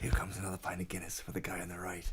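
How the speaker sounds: hushed whisper of a wildlife narrator; whispered nature documentary. Retold or imitated. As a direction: whisper it like watching animals. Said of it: "David Attenborough documentary".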